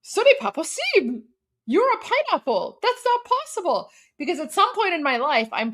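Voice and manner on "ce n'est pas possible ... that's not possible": put-on proud child. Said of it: "I'm like".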